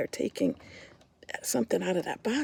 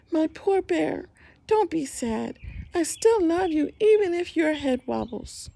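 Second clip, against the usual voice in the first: ordinary delivery, nothing marked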